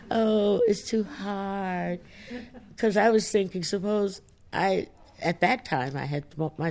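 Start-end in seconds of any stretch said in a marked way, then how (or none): 0.1-2.0 mock whiny complaint. Mocking the complaint she feared saying, slow mock whine. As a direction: stretch it out like a teasing sulk. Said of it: "if I said".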